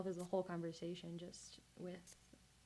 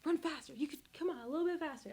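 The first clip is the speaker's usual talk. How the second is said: higher-pitched mock yell at runners; retold cheering yell; 8 semitones higher, 3 dB louder, faster than usual. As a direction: mimic urging on racers without really yelling. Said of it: "I was yelling when I'm like".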